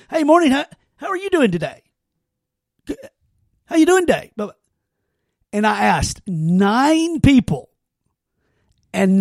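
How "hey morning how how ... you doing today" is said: bright, higher, upbeat hello to coworkers; cheery greeting. Shown as a demonstration. Greeting coworkers in the office, mock-cheerful hello. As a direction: pitch it up like a friendly morning greeter. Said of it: "this was a social experiment".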